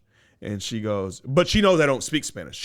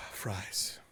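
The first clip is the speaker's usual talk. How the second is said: sighing; 4 semitones lower, 14 dB quieter than usual. Real, not acted. Host remembering the carne asada fries, sighing. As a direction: melt into a longing sigh.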